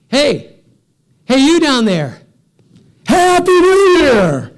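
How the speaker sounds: high, booming holler; loud acted shout from a big stranger; calling out. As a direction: bellow a drawn-out New Year greeting. Stranger calls down to him.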